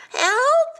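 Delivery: high, loud panicked yell; cry for help. A narrator's reading. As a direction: call out while plummeting. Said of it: "he cried".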